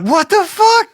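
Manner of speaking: put-on high, loud shocked yelp from the girls; the girls shriek when he appears in their car, imitating high shock. Girls shocked to find him in their back seat, high loud yelp.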